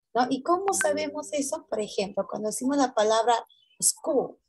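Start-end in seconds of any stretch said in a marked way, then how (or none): none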